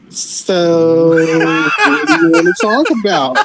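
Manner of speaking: slow, smug mock question; snarky Cody impression. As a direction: drawl it out smugly. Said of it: "he'd be like".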